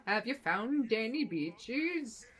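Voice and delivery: slow, drawn-out hint of a Swedish accent; lightly done Swedish dwarf accent; mock Swedish accent. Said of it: "Is this the way that Swedish people talk".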